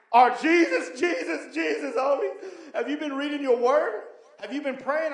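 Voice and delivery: loud, high, building on each Jesus; excited preaching. Retold or imitated. Friend preaching loudly to him about the Holy Spirit. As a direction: preach it with fire.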